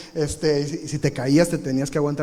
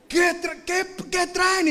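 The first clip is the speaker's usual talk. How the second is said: mom bursting in; 13 semitones higher, slower than usual. Retold or imitated.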